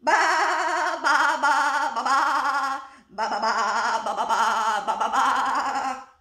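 loud, quavering sung bleats; vibrating baa-baa melody; sheep rehearsing a song. A narrator's reading. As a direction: belt the tune out as a sheep. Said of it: "The sheep rehearsed".

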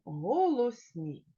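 ordinary delivery

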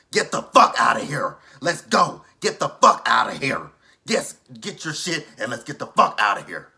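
loud furious yelling at Tony; Angela's angry voice. Retold or imitated. Vlogger voicing Angela yelling at Tony, furious. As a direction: yell like a bride who's had enough. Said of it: "I wanna do her voice".

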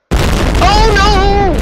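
high, loud "oh no" cry; loud cry of dismay. Real, not acted. Player reacting to a game mishap, loud dismayed cry. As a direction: yell out in sudden dismay.

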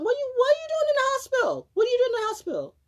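hammed-up high-pitched worried friend; mock alarmed friend. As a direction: parrot the worried question in a high rushed voice. Mimicking friend's alarmed question.

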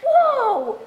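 high, loud burst of surprise; wowed by the news; amazed whoa. Acted. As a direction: react with a big astonished whoop. Friend reacts to big news.

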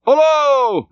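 very high, drawn-out hullo; hailing back. A narrator's reading. Starbuck returns Stubb's hail, hollering. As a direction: holler back across the water.

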